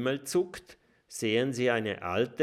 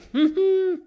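loud squeaky burst of laughter; silly high-pitched laugh; high giggle; 11 dB louder than usual. A narrator's reading. Laughing at funny names.

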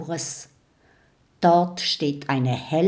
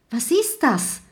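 high call, only slightly raised; startled call; 7 semitones higher than usual. A narrator's reading. Mathilda sees something coming at them, calling out high. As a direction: call out in mild alarm. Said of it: "ruft Mathilda".

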